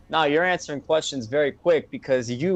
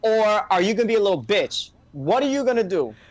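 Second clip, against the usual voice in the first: retold heated challenge; 6 semitones higher than usual. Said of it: "I lost my temper".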